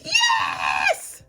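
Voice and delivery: very high, loud gasp and yell; ecstatic shriek. Real, not acted. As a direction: scream with joy. The result is revealed.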